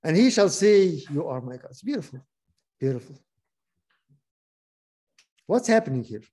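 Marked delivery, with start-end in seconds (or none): 0.0-1.7 measured, earnest Bible reading; scripture reading. Acted. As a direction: read the verse like a preacher to a class. Reads Hosea aloud.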